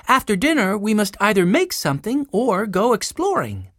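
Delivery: put-on high, animated girl's voice; organizing girl Jessie, keen. A narrator's reading. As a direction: lay out plans like a keen elder sister. Jessie making plans.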